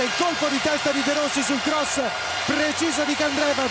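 high, rising goal call; breathless italian goal commentary. Real, not acted.

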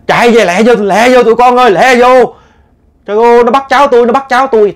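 put-on high, loud panicked yelling of an old woman; frantic shout. Grandmother yelling to her grandkids on the bike, high panicked shout. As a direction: scream at the grandkids to hurry in, terrified. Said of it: "bà la làng lại".

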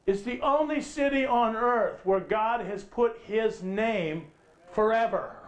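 ordinary talk, nothing marked

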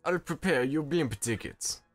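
mafia boss. Acted. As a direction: hand out orders like a Don. Mafia boss dialogue read aloud.

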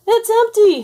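high, loud shocked shriek. Acted. The ghost finds the fridge empty, shrieks high and loud. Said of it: "he shrieked".

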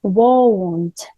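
won't said with a rounded diphthong, "woʊnt"; pronunciation demo. Shown as a demonstration. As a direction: slowly spell out the vowel glide in won't. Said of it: "по-іншому вимовляється". (вимова won't з двома голосними, "воунт")

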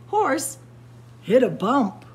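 usual speaking voice

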